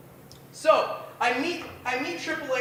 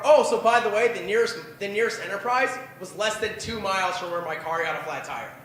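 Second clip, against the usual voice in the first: ordinary talk, nothing marked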